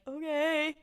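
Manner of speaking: high-pitched, meek mock reply; sheepish okay. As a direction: squeak out an awkward okay. Dean's reply after being hung up on.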